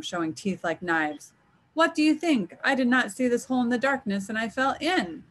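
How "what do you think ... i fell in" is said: high-pitched, indignant leopard; mildly growled retort. A narrator's reading. Trapped leopard answering Anansi from the hole, irritated retort. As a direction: snap back, pitched up, with mild anger. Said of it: "Osebo growled at him".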